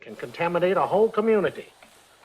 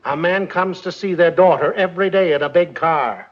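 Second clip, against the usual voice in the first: raised voice, projecting to the room; louder repeat; 6 dB louder than usual. Acted. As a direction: say it louder so everyone hears.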